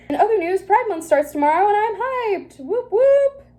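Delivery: high, bright mock cheer; excited. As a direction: read it with hammed-up glee. Mock cheerful diary entry read.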